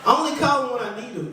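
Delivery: ordinary delivery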